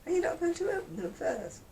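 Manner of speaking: high, rising mock question; mimicking pushy gift-giver. Mocking someone asking to open a gift, mimicking voice. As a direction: put on a high, expectant guest voice. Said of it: "and then ask them to open them".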